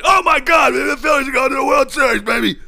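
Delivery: mocking pundits cheering like fans; mock hype. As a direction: ham up the fair-weather hype. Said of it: "and then they win the next thing".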